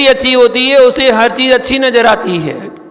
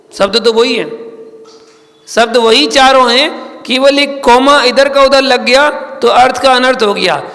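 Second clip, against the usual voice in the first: ordinary delivery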